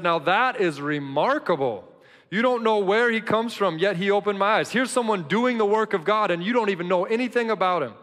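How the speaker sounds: loud, high, defiant reply; bold defiant answer. Acted. Healed man answering the Pharisees, loud and defiant. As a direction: stand up to them boldly. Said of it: "The man answered".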